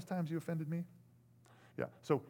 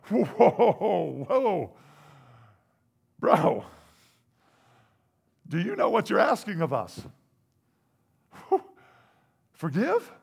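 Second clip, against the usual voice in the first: shocked disbelief; 4 semitones higher, 10 dB louder, slower than usual. Acted. Disciples shocked at command.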